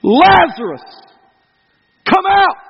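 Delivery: calling out to a dead man, full voice; loud shout. Acted. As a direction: shout his name into the tomb. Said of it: "he shouted with a loud voice".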